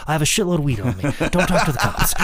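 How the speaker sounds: giggling as the joke lands; can't keep a straight face; laughing through the quote. Retold or imitated.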